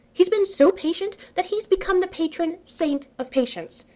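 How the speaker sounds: exasperated narration. A narrator's reading. Narrator fed up with how long the waiting takes, exasperated. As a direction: push the exasperation up, almost wailing.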